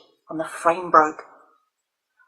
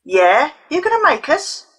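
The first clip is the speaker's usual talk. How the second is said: taunting; 9 semitones higher, 4 dB louder than usual. A narrator's reading. Leo taunting the girls.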